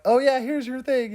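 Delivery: high, airy and offhand; mock breezy handover. Imitating the woman sending out the tapes, mock breezy. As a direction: toss it off as if harmless.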